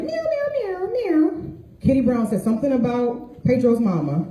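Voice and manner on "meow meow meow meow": high mock cat meows; cat meowing. Cats trading insults in meows. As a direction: meow like an angry cat picking a fight. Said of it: "It was like".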